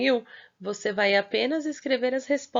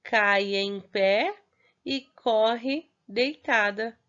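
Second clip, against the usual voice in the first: cai em pé e corre deitada read slowly, word by word; teacher reading the second riddle slowly; much slower than usual. Shown as a demonstration. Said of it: "ó, fala assim".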